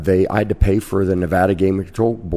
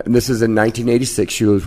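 normal talking voice

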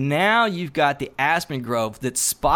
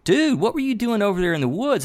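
surprised; 8 semitones higher than usual. Retold or imitated. Confronts Josh after sunset.

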